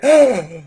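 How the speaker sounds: loud acted gasp; gasp of realization. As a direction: gasp as if it suddenly dawns on you. Acting people's sudden realization.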